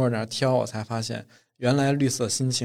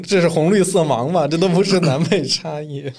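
higher and louder, mocking the claim; loud teasing retort; 5 semitones higher, 6 dB louder than usual. Real, not acted. Podcast friend mocking the north-south claim, loud teasing retort. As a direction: tease back loudly, half laughing.

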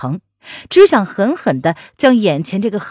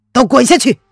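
loud, high-pitched furious command; cold, angry shout; 5 semitones higher, 7 dB louder than usual. A narrator's reading. Emperor dismisses the maids outside the door, cold angry shout. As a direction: shout it as an icy order. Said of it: "冷声地喝道".

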